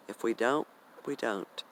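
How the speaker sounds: ordinary talk, nothing marked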